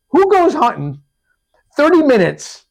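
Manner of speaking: high, loud incredulous rant; exasperated mock telling-off; indignant confrontation. Retold confrontation with the other hunter.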